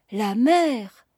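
a touch of youthful wonder; enthusiastic exclamation. A narrator's reading. Young Chantepie hearing of ships at sea, enthusiastic exclamation. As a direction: exclaim it with mild rapture. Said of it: "s'écria Chantepie".

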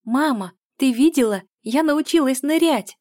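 put-on high, loud, thrilled little girl; excited child's voice, rising in pitch and volume; delighted child. A narrator's reading. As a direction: burst out proudly to mom. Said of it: "произнесла она с восторгом".

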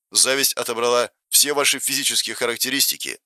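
ordinary delivery

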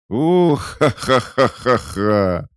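high, merry burst of laughter. A narrator's reading. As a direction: laugh out loud with delight. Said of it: "Богини весело рассмеялись".

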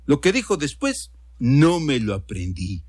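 plain reading voice

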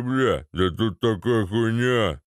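slow, drawn-out lout voice; put-on slow thug whine; thug making excuses. A narrator's reading. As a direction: drag out the excuse like a dim paratrooper. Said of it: "оправдывался десантура".

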